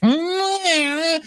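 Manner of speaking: high siren-like wail; mock siren wail. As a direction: imitate a fire truck siren. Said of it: "fire truck siren".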